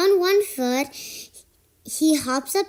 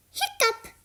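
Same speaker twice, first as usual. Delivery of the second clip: squeaky high hiccup; 12 semitones higher, 4 dB quieter than usual. Acted. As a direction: pop a high little hiccup. Hiccup sound.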